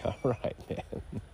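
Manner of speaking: whispered grudging agreement; hushed reluctant okay. Retold or imitated. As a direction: mutter it under your breath. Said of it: "I was like".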